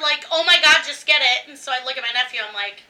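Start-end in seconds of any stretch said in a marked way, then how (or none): 0.3-1.5 exasperated. Retold or imitated. Family pushes dessert.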